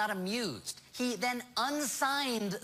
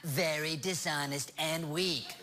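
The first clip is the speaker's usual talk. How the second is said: Trump impression; 3 semitones lower than usual. Talk show host reads out the president's tweet, presidential impression. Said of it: "then Trump tweeted".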